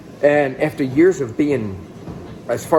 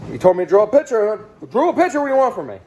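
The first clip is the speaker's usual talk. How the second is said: frustrated retort; 9 semitones higher, 3 dB louder, faster than usual. Retold or imitated. Retorting to the therapist.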